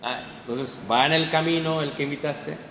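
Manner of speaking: usual speaking voice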